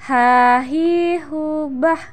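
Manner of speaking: "hahihubah" drawn out with mid-throat h; slow chanted "hahihubah" pronunciation drill. Shown as a demonstration. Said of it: "dikeluarkan dari tengah tenggorokan kita".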